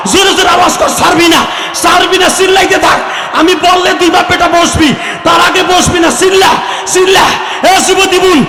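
high, loud impassioned preaching cry; preaching at full force. Acted. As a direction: rouse the crowd to weep and shout. Preacher urging crowd to weep. (উচ্চস্বরে আবেগঘন ওয়াজের চিৎকার)

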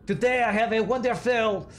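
high, hammed-up French chef accent; bad French accent. Chef presenting dishes to a diner, bad French accent. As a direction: serve it up slowly in a mock French accent. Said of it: "That was my bad French accent".

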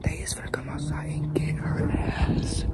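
slow hushed whisper. Real, not acted. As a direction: whisper it urgently, slowly. Whispering so the neighbours won't hear.

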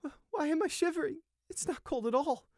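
very high, stammering whimper; whimpering and shivering. A narrator's reading. Shocked sheep questions his own shivering, whimpering. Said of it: "He was whimpering now and shivering".

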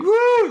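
very high, loud hammed-up wailing cry; mock wail. Guests waiting while Lanford wails behind the door, high hammed-up wail. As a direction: howl it like a writer sobbing behind a door. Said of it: "like, wailing behind the door".

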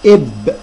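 إب cut off on a closed ب, no vowel after it; teacher isolating the closed syllable إب; stop on the ب. Shown as a demonstration. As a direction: end إب firmly on a vowelless b. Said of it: "شوفوا وقفنا على الباء إزاي؟".

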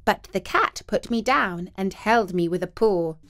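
usual speaking voice

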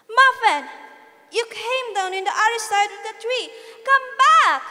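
high, loud yell after a runaway cat. A narrator's reading. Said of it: "Timmy yelled".

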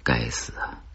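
plain reading voice

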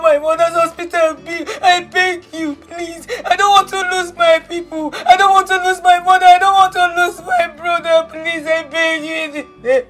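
insistent, pleading repetition; desperate begging. Acted. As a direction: beg desperately for your family. Begging uncle to save family.

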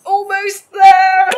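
loud, slow, eager call; urging shout. Acted. Mermaid urging the man closer, loud and slow. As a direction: coax him the last inch, loudly.

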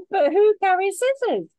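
high, loud and giggling; laughing. Acted. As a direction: crack up at your own joke. She laughs at her revenge.

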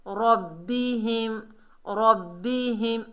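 रब्बिहिम slowly, pausing on the double b; firm doubled letter. Shown as a demonstration. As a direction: press and hold the bb in रब्बिहिम. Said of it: "मजबूती और जमाव से".

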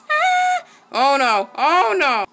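loud, high yelp into oh no; loud gamer's dismay; alarmed cry. Real, not acted. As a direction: cry out in sudden panic. Alarmed reaction to the game.

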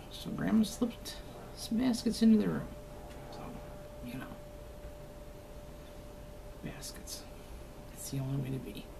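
hushed, playful stage whisper; secretive whisper. Pretend. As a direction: whisper it like a sneaky grandma. Secretly sneaking baskets in.

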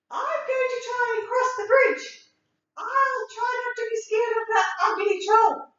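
high, small billy goat voice; a tiny nervous goat; little goat voice. Acted. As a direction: squeak it out like the tiniest goat.

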